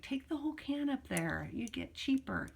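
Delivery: lightly voicing her aunt; aunt's advice. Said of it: "my aunt was like".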